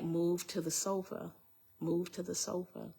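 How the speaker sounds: soft, level command; retold inner voice. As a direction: give the order quietly, without pushing. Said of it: "I hear this voice and it says".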